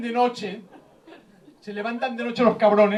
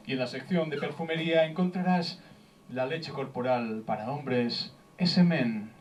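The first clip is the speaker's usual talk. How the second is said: low, slow, sultry ad read; mock advert voice-over; 6 semitones lower, 4 dB quieter than usual. Comedian sells men's body lotion, mock slow perfume-ad voice. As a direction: draw out the product name, lower and softer.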